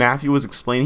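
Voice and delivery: usual speaking voice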